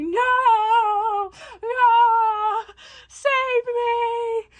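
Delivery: high, drawn-out wail for help; hammed-up high-pitched panicked pleading; mock freaking out. Odysseus freaking out at the sirens, hammed-up wailing. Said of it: "freaking out the whole time".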